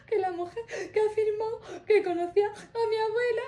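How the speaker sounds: imitating herself sobbing and laughing at once on the phone. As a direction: wail it out like a retold crying fit. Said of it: "hecha una magdalena llorando".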